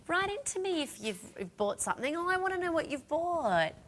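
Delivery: high and keen; eager. Real, not acted. Host urging shoppers to share purchases, eager. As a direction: beg them to tell you, excited. Eagerly asking viewers to write in.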